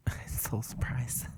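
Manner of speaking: slow, whispery, very soft mock surprise; soft-spoken professor. Co-host imitating a soft-spoken professor, whispering in mock surprise. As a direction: whisper it like a gentle academic. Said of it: "a really soft-spoken professor".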